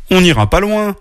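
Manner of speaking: high, coaxing insistence; insistent little bear. A narrator's reading. Little bear coaxing his sister outside, insistent. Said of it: "insista petit ours".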